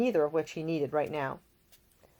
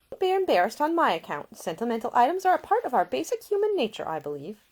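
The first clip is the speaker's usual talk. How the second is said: higher, brighter-pitched caring lady; a young woman tending a seasick man, solicitous and higher; Ida, gentle young woman; 6 semitones higher, 4 dB louder than usual. A narrator's reading. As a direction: voice the attentive young lady nursing him. Ida speaking.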